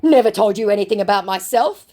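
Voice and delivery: lightly voiced nervous reply; mildly panicked. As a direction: read it as a mildly rattled scammer. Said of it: "mildly panicked".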